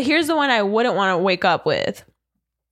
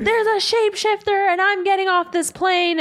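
retold scream; 10 semitones higher than usual. Retelling a viral plane meltdown, mock scream. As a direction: imitate a screaming passenger without full volume. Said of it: "she's screaming and she's like".

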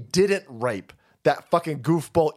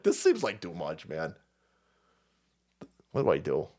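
low, quiet, hesitant aside; uneasy criminal; 11 semitones lower, 6 dB quieter than usual. Acted. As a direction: voice an uncomfortable crook, low and hushed. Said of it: "this fucking criminal over here is like".

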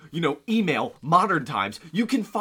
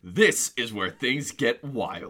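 slow, lower ominous teaser read; dramatic read-out; 7 semitones lower, much slower than usual. Retold or imitated. Reading a headline aloud to his co-host, slow and ominous. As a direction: read it out slowly like a cliffhanger. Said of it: "in quotations".

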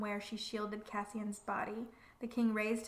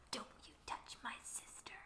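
much softer, breath only; snarled whisper; 15 dB quieter than usual. A narrator's reading. Elaine whispering a threat in the king's ear. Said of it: "she snarls and whispers in his ear".

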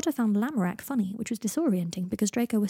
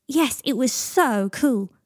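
loud, higher, slow and drawn out; thrilled child; 4 semitones higher, 7 dB louder, much slower than usual. A narrator's reading. Teddy confirming to Harry it was a real shark, thrilled. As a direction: relish every word with childish glee.

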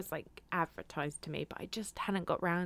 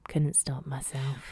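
slow hushed guilty whisper, lower; sheepish whisper; 5 semitones lower, slower than usual. Retold or imitated. Mum admitting her prank, sheepish whisper.

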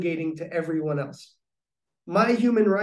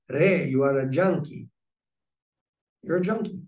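put-on Greek accent. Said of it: "with his Greek accent".

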